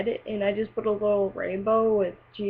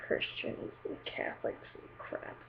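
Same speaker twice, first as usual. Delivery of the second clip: hushed whisper under the breath; muttered aside, whispered; 14 dB quieter than usual. Real, not acted. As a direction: drop to a whisper for the dig.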